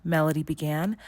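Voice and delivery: ordinary delivery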